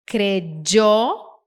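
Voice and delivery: "creyó" slow, kre-DJOH with hard j. Shown as a demonstration. Teacher recapping options, "creyó" with dj sound. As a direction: stretch "creyó" with a dj burst for y. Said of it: "com D J".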